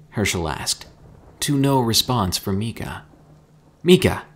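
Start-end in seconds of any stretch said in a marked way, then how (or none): 3.8-4.2 sharp, high, loud call of a name; shout. A narrator's reading. Narrator shouts at his unresponsive friend. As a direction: shout to snap someone out of it. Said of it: "I shouted".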